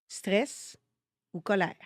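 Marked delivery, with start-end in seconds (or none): none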